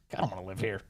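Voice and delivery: low mumbling sulk; lightly voiced sulking migrant; mock sulky migrant. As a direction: grumble it under your breath. Voicing migrant.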